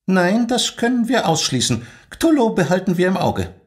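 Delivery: high, cool and formal rebuke; stern school head demanding silence; strict headmistress. Acted. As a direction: put the eager trainee in her place. Headmistress answers Hassan.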